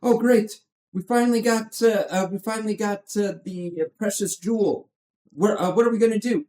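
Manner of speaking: high, hammed-up movie hero parody; mock-excited cartoon spoof of an adventurer; horrible Kirk Douglas impersonation. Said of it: "that was a horrible Kirk Douglas, uh, impersonation".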